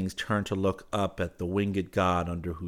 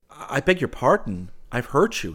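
loud, higher stammered apology; dismayed cry; 7 semitones higher, 6 dB louder than usual. A narrator's reading. Suitor apologizes for hurting her, crying out. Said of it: "he cried".

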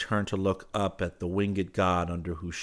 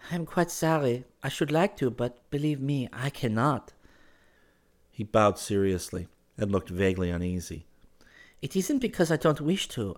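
put-on regretful earnest girl; 6 semitones higher than usual. Girl tells him she cannot receive him, regretful. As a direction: decline earnestly in a raised pitch.

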